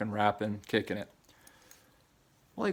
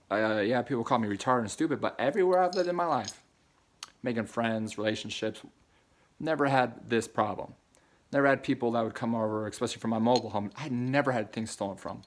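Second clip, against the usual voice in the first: normal talking voice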